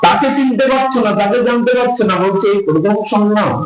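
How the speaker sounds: high, rising exasperated Lalita; Lalita scolding. Retold or imitated. Lalita scolds Radha for not knowing Shyam, high and exasperated. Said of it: "বলে".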